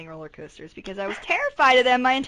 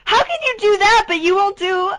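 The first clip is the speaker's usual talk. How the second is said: higher and louder, in real disbelief; can't believe what she's hearing; incredulous outburst; 9 semitones higher, 6 dB louder than usual. Real, not acted. Friend afraid of pirate ship.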